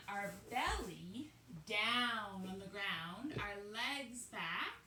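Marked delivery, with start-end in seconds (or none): none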